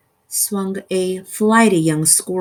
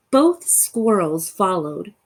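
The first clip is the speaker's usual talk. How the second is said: plain reading voice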